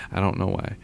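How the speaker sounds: ordinary talk, nothing marked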